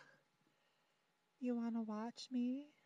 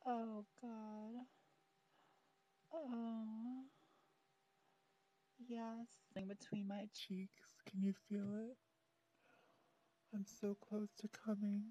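ordinary delivery

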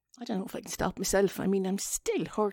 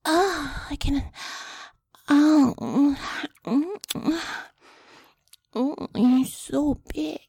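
put-on baby voice; 7 semitones higher, 8 dB louder, much slower than usual. Shown as a demonstration. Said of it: "I can do the baby voice".

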